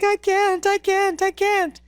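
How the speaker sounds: very high tearful whine, imitated; mock crying. Climber alone with oxygen tank, mock crying. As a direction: whimper it over and over, hammed up. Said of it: "and, like, crying".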